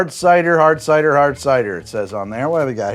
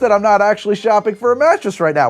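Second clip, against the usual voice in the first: higher, energetic pitchman delivery; sponsor ad read; 3 semitones higher, 5 dB louder than usual. Acted. Host pitches sponsor's mattresses to viewers, punchy ad read. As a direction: sell it brightly and emphatically to the viewers.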